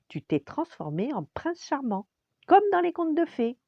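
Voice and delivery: high voice climbing to a loud squeal; excited princess. A narrator's reading. Princess telling the frog he is transformed, excited.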